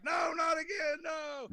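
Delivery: a high, loud wail of despair; anguished cry. Retold or imitated. Dog walker dreading another body, high loud despairing wail. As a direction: howl it like a man cursed to repeat this. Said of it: "he's like".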